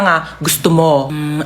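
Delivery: ordinary talk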